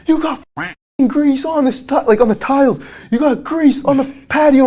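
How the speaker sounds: high, loud, hammed-up angry yell; a red-faced homeowner ranting about grease, high and loud; furious client yelling. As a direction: screech it like a raging brute. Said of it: "And he's like".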